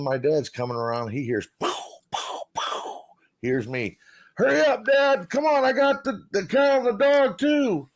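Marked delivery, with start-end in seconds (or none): 1.6-3.0 whispered gunshot sounds. Retold or imitated. Imitating distant gunshots. 4.3-7.9 much higher, louder retold call; a kid calling his father to hurry; calling out to dad. Son calling to dad in story.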